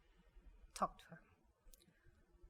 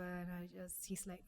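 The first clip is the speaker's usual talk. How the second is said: ordinary delivery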